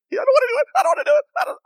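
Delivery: very high, loud panicked shriek; mock terrified scream. Imitating a guy on a roller coaster, screaming in panic. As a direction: imitate a man screaming his protest. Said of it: "he's screaming bloody murder".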